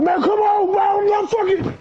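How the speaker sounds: high, loud impatient shout; dad yelling at his son to leave; retold yell. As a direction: shout him out of the room. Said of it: "he's yelling at me some more".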